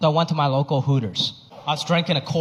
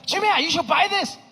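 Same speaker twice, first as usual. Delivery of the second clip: higher, eager bargain-hunting mom; excited mom voice; 8 semitones higher than usual. Retold or imitated. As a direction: hype the deal like an excited mother. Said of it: "She's like".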